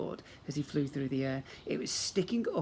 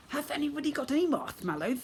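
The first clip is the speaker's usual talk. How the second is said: put-on lisping old sister; 12 semitones higher than usual.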